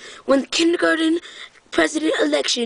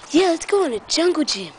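normal talking voice

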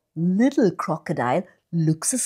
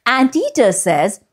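ordinary delivery, nothing marked

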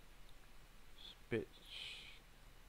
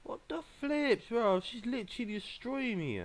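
very high, squealed protest; alarmed disbelief; 11 semitones higher than usual. Real, not acted. Player being destroyed by an opponent, alarmed. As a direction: wail upward in real panic.

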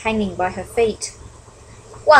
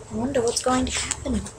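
much softer, hushed wondering; suspenseful hush; 2 semitones higher, 8 dB quieter than usual. Real, not acted. Reader whispers suspense.

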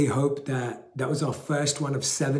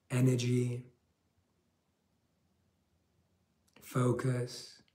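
guided meditation; 2 semitones lower, 6 dB quieter than usual. Acted. Leads a guided breathing meditation.

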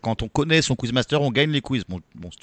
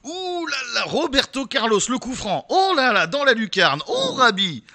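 much higher, thrilled sports call; excited commentator; 9 semitones higher, slower than usual. Real, not acted. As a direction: call it like a dream goal. Commentator compares point to Roberto Carlos. (commentateur de foot enthousiaste)

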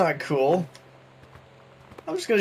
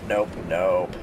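"nope, nope" dropped much lower; deep nope; 10 semitones lower than usual. Shown as a demonstration. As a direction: growl "nope, nope" way down low.

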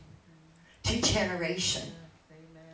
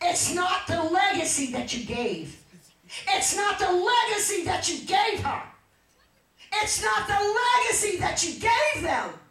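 insistent repeats climbing higher each time; building preaching; 10 semitones higher, 4 dB louder than usual. Real, not acted. Preacher urging Sister Jean toward victory, building preaching.

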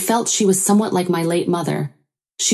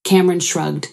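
plain reading voice

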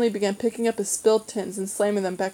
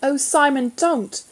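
raised, distressed appeal; pleading, pitched-up dismay; unhappy plea; 5 semitones higher, 4 dB louder, slower than usual. A narrator's reading. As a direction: beg your brother to stop, unhappily. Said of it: "Jane said unhappily".

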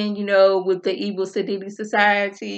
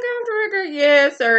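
mocking mimicry; 9 semitones higher than usual. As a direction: parrot him back in a silly high squeak. Mocking Alan's answer.

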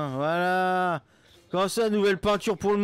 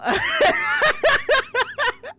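loud shrieking laugh; 3 dB louder than usual. Real, not acted.

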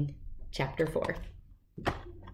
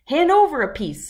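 high, louder bossy demand; 8 semitones higher, 6 dB louder than usual. A narrator's reading. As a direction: order it like a bully. Bully demands chocolate.

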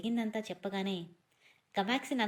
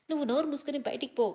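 higher, louder, fast yell to get out; furious shout at her brother, higher and louder; 6 semitones higher, 4 dB louder, faster than usual. A narrator's reading. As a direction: shout him out of the room, fast and high. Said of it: "గట్టిగా అరవడంతో".

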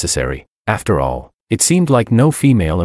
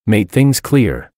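plain reading voice

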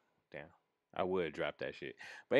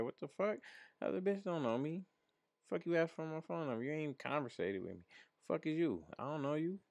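squeaky-high indignant rant, kept soft; mock indignant; 8 semitones higher, 5 dB quieter than usual. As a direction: act out being put out by a stranger. Retold indignation at phone number request.

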